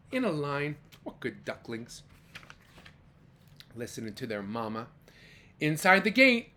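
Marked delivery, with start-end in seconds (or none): 1.0-4.9 lower, softer fond aside; tender. Acted. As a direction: praise the ducklings gently.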